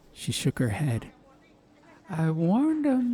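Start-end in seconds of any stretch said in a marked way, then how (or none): 2.1-3.1 high, slow, resigned mother; Ma, weary. Acted. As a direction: shake your head and sigh it out. Ma's voice.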